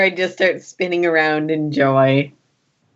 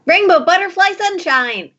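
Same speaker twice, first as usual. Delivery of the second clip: high-pitched little droid voice; perky droid character; 12 semitones higher than usual. Acted. Droid telling its name to a questioner, high character voice.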